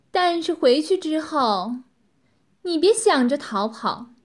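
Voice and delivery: loud, commanding woman's voice; domineering mistress, threatening. Acted. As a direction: warn the captive with full authority.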